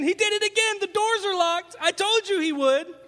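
high, loud, breathless disbelief; excited disciples. Retold or imitated. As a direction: gush it out like you can't believe it. Disciples excitedly telling Thomas again.

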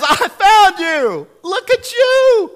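very high, loud and tearful with joy; Jesus overjoyed at finding the lost sheep. Acted. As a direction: sing out the rescue, near tears with delight. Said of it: "and says".

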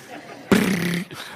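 vocal imitation of a ticking population counter; counter sound effect. Shown as a demonstration. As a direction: make the counter noise with your mouth. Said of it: "You like that sound effect?".

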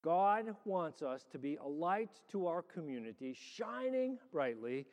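rising, slowing preacher's call; preaching. Acted.

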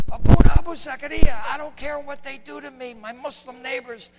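high, strained voice with breaking whispery bursts; Hana. Retold or imitated. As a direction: imitate a wounded man forcing his words out. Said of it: "so now he talks like this".

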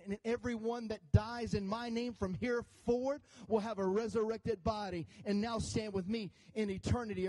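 ordinary talk

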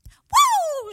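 loud high whoop; imitating mother's whoop. Daughter imitates mom's whoop in prayer, joyful whoop. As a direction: let out a joyful whoop like mom. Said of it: "she goes".

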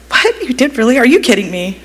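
retelling her shocked reaction as if she can't believe it; surprised disbelief. Retold or imitated. Leader retelling her reaction to a stoic boy's confession, surprised disbelief. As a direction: jump way up high on What, then settle into amused disbelief. Boy admits he cried at camp.

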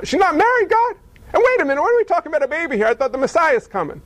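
high, fast, tumbling protest; the angel sputters his objections in a rush; flustered Gabriel. Acted.

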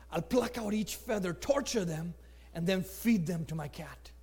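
low, soft acted threat; understated cruel boy; cool menace. Boy coolly threatens the birds.

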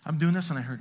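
ordinary talk, nothing marked

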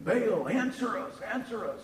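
high, pleading call to a silent god; mock desperate pagan call; retold shout. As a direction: cry out to Baal, drawn out. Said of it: "they shouted".

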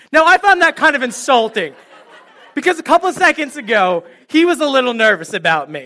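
amused mock offense, laughing; laughing indignation. Real, not acted. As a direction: laugh at the insult.

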